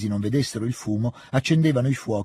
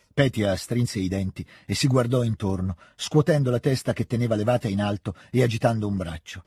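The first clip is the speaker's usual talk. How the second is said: plain reading voice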